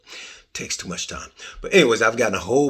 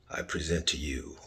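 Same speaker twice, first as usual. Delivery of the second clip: softer, whispered title reveal; dramatic whisper; 6 semitones lower, 10 dB quieter than usual. Pretend. Host unveiling the podcast title, dramatic whisper. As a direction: hush it like a secret unveiling.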